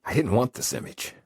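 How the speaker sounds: usual speaking voice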